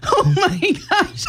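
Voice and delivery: high, stretched-out exclamation through laughs; real helpless laughter. Real, not acted. Host seeing co-host's big bite of donut, helpless laughing. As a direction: crack up while saying it.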